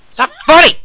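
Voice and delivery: high, loud mock protest; mock outrage yell. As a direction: shriek it in fake outrage. Yelling at a laughing friend.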